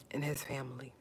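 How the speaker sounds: trailing, tearful whisper; real whisper as tears come. Real, not acted. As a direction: let it fade to a breath. Tearful plea for prayers.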